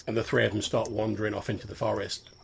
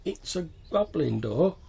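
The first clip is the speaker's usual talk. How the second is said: slow, patient, higher; explaining to a child; 6 semitones higher, slower than usual. Acted. Goblin explaining to Mick, slow and patient. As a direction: say it slowly like to a child. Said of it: "trying to explain something to a child".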